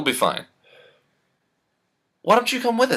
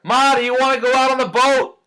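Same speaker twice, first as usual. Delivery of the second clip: high call to another room; 9 semitones higher, 3 dB louder than usual. Acted. Son calling to his mother, raised voice. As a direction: call out to mom. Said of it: "Calling to her".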